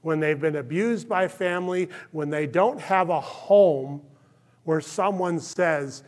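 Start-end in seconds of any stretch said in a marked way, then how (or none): none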